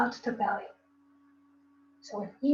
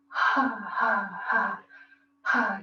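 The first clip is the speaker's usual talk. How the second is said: demo laugh; 2 semitones lower, much slower than usual. Shown as a demonstration. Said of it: "Exhaling like you're laughing".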